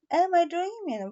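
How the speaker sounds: a touch of dazed wonder; wondering aloud. Acted. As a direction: sound as if waking into a dream. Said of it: "wondered Bobo out loud".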